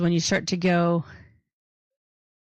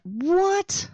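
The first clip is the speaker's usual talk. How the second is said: high-pitched, incredulous what; retold astonishment; shocked reaction; 11 semitones higher than usual. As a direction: blurt it out in disbelief, pitch leaping up. Acting out shocked first reaction.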